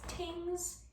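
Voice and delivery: tings, TH stopped to a T; TH stopping. Shown as a demonstration. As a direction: things said as tings. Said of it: "things becomes tings".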